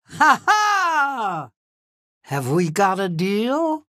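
normal talking voice

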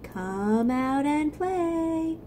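slow, high, sing-song call. Acted. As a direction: coax him out to play. Character voice in read story.

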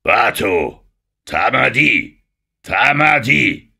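lautes, gedehntes Gebieten; gebieterischer Häuptling. Acted. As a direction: befiehl den Fremden laut Halt. König gebietet Fremden Halt. (herrischer Ruf des Häuptlings)

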